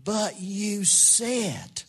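very slow, drawn-out reminder; slow voice inside the head; little inner voice. Retold or imitated. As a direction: stretch each word like a nagging conscience. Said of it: "I heard this little voice into my head".

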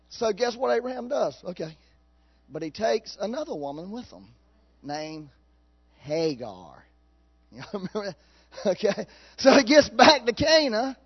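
ordinary delivery

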